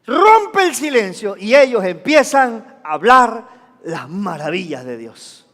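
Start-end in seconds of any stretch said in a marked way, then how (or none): 3.8-5.5 low, soft landing; hushed awe. Real, not acted. Preacher ends on what the disciples spoke, hushed awe. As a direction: drop suddenly to a reverent hush. Drops to hush after climax.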